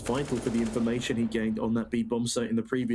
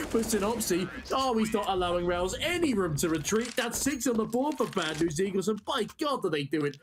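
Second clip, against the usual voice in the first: esports caster; 8 semitones higher than usual. Real, not acted. Live play-by-play.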